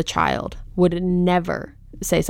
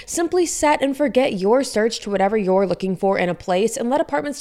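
higher-pitched, polished sponsor read; 4 semitones higher than usual. Acted. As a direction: sell it like a podcast advert. Reads a sponsor ad.

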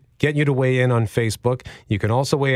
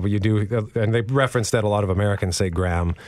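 ordinary talk, nothing marked

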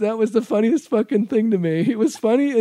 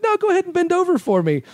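insistent; 11 semitones higher, faster than usual. Retold or imitated. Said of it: "And I'm like".

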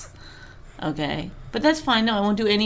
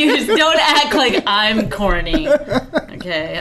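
laughing; 4 semitones higher, 5 dB louder, slower than usual. Real, not acted. Laughing while teasing.

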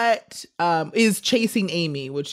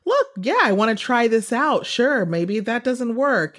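higher-pitched, lightly voiced quote of her; voicing the character; 7 semitones higher than usual.

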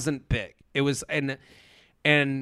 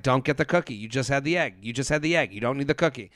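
hushed self-talk; 3 semitones lower, 7 dB quieter, faster than usual. Retold or imitated. Inner voice telling herself no.

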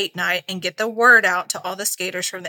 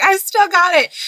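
cocky; 10 semitones higher, 7 dB louder than usual. Retold or imitated. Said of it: "I was like".

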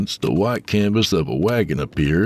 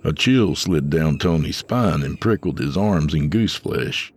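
ordinary delivery

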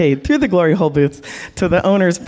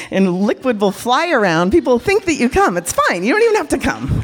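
ordinary talk

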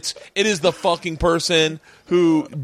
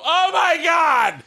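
mock scream; 9 semitones higher, much slower than usual. Shown as a demonstration. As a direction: wail to get attention. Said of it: "you just have to sit in the waiting room and just go".

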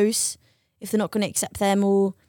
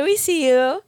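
usual speaking voice

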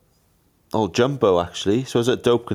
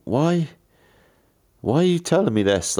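retold bafflement; 9 semitones higher than usual. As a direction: question his motive in mock disbelief. Retold suspicious thought at rival.

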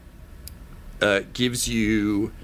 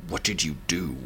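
low, quiet, ominous demand; darkly menacing; 4 semitones lower, 6 dB quieter, faster than usual. Acted. Cinder realizing what his brother did, darkly menacing. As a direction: drop deep and soft with menace. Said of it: "his face darkens".

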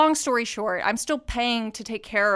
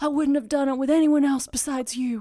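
a hint of melodrama, pitched higher; lightly acted movie-hero line; 4 semitones higher than usual. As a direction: deliver a closing movie line, lightly hammed. Imagined movie-ending line to horse.

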